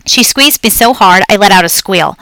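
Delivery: plain reading voice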